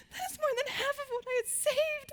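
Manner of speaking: high, crying-tinged mock dismay; mock distressed wail. Retelling her shock at the ninety-dollar bill, mock wail. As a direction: whimper in hammed-up shock at the price. Retold shock at checkout price.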